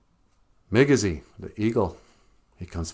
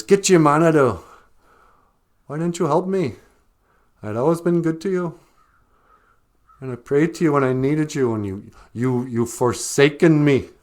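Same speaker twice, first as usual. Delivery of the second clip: mad rabbit; 6 semitones higher, 5 dB louder than usual. Acted. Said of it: "he's just mad".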